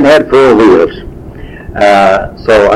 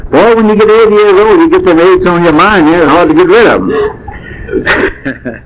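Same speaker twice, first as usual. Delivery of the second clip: laughing; 7 semitones higher than usual. Real, not acted. Laughing at his own slip.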